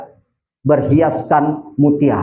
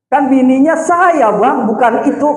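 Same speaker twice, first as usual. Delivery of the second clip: wife's protest; 8 semitones higher than usual. Retold or imitated. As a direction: act a wife objecting, pitched up and stretched. Wife protests in retold story. (protes istri dengan nada tinggi)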